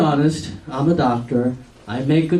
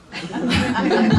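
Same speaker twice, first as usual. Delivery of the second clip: a real laugh at his own joke; short rising chuckle; amused laugh; 7 semitones higher than usual. Real, not acted.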